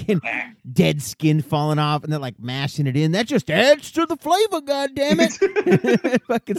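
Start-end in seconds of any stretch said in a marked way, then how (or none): 3.1-6.6 high, drawn-out hollering put-on voice; hammed-up grape stomper. Imagined grape stomper defending dead skin in the wine, hammed-up holler. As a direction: holler it high and stretch the last word.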